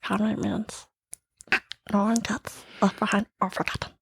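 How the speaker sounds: small alien rasp, fading to a hush; a cartoon alien sidekick, softly; Stitch voice. Shown as a demonstration. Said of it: "Or Stitch".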